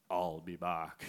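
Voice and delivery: low, soft put-on voice; mock action-hero line. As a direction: deliver it as a deep joke catchphrase. Jokey low film-style reply.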